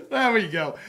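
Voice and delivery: real laugh, high and loud; amused, breaking into laughter. Real, not acted. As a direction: laugh through the words at your own silliness. Laughing at own silly accent.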